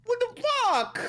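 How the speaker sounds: shrieking high disbelief; high-pitched incredulous outrage. Real, not acted. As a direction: squeal it out in total disbelief. Incredulous rant about Melania.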